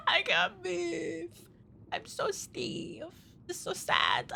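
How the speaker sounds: slow, hushed, fading whimper; soft, drawn-out pretend sadness; pitiful mock lament. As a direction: play it feebly sorry for yourself.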